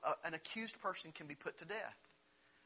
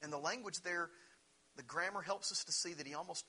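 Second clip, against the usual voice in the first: ordinary talk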